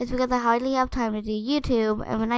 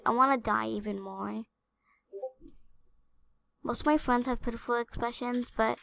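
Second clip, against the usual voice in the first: subdued; 8 dB quieter than usual. Real, not acted. Upset, quiet confession after crying.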